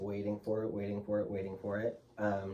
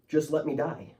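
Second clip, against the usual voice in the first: retold scream; 6 semitones higher, 4 dB louder than usual. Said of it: "screaming to the doctors and nurses".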